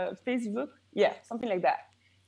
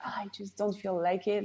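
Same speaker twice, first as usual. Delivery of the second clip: softer, quick acted reluctance; unmotivated grumble; 5 dB quieter, faster than usual. Coach voicing a bad morning mood, unmotivated. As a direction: grumble it quietly, as if dreading the day.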